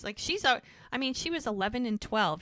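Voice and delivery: ordinary delivery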